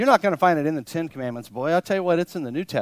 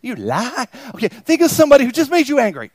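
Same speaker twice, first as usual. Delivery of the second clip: ordinary talk, nothing marked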